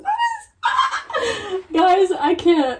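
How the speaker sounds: high, slow words through laughter; laughing. Real, not acted. Laughing at own mistake.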